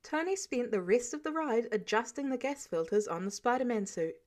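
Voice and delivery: usual speaking voice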